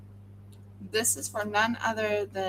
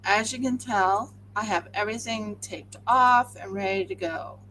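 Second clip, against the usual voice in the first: ordinary talk, nothing marked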